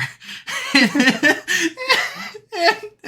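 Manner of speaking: long, high burst of real laughter. Real, not acted. Reviewer cracks up retelling the Godfather gag, high laugh. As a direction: crack up at the joke.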